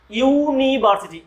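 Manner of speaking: ইউনিভার্সিটি said slowly, starting with "you"; ইউনিভার্সিটি sounded out slowly for students; slow pronunciation demo. Shown as a demonstration. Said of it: "ইউ এর মতন উচ্চারণ।".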